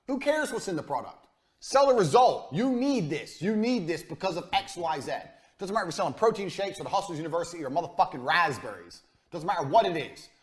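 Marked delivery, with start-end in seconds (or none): none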